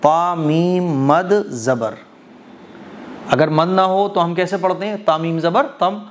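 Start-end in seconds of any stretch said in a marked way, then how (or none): 0.0-2.0 slow deliberate hijje; slow spelling-out. Shown as a demonstration. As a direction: spell it letter by letter slowly. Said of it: "इसकी हिज्जे भी ऐसे ही करेंगे". (धीरे धीरे हिज्जे)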